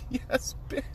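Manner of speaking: high, soft, whispery cheer; hushed triumph. Retold or imitated. Fan retells seeing Spider-Man land, hushed triumphant reaction. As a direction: squeeze out a thrilled near-whisper. Retold delighted reaction in cinema.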